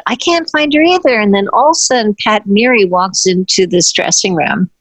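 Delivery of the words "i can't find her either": high, fretful imitation of the assistant; worried assistant. Assistant joining the search, lightly mocked worry. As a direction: toss it off as a flustered assistant. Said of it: "the assistant said".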